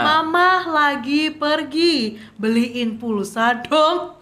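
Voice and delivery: very slow, exaggeratedly careful message reading; reading aloud. Shown as a demonstration. Co-host demonstrates reading mom's WhatsApp as practice, reading slowly aloud. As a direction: stretch every word as in a reading drill. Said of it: "WhatsApp dari mama kamu yang kayak nanyain".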